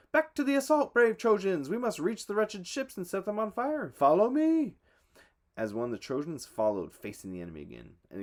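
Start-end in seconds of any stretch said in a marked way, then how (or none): 0.1-4.8 loud, high battle cry; rallying shout. A narrator's reading. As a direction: shout over the din of battle. Hector rallies troops.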